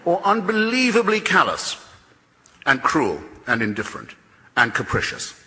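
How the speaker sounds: callous lifted sharply high; indignant emphasis. Real, not acted. Debater scorning the designer's cruelty, sharp indignant lift. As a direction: punch unbelievably callous with scorn.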